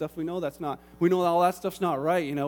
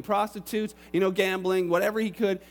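ordinary talk

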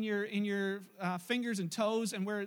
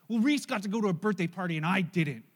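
put-on louder, sulking little boy complaining; sulky child voice; 2 semitones lower, 5 dB louder than usual. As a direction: act out a grumpy kid who missed a party. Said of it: "began to ask him".

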